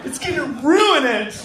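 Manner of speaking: high, slow whining complaint; whiny passenger. Retold or imitated. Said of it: "You're like".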